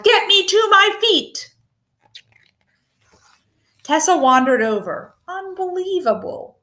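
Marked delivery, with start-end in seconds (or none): 0.0-1.5 much higher yelled order; demanding yell. A narrator's reading. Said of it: "he yelled". 5.3-6.6 soft, higher sighed word. A narrator's reading. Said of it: "she sighed".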